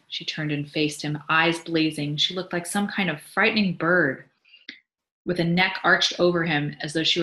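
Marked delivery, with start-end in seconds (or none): none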